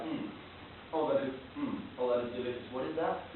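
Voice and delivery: soft, low, relishing murmur to oneself; savouring musing. Acted. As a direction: muse quietly as if tasting something delicious. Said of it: "a bit weird to hear this".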